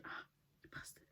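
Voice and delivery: breathy whispered insult; whispered curse. Real, not acted. Cursing the head chef to himself, whispered. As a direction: curse him under your breath. Muttered aside about the chef.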